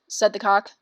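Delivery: ordinary delivery, nothing marked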